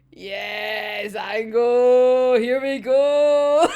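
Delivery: loud cheering shout, drawn out slowly; excited cheer. Retold or imitated. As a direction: hype a favourite character's entrance. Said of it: "And it's like".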